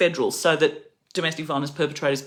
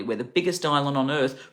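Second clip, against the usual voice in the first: ordinary delivery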